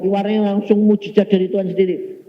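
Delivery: loud and high, proclaiming the miracle; preaching loudly. Real, not acted. Preacher proclaiming God's miracle to the congregation, loud and high. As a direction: call it out to the whole church.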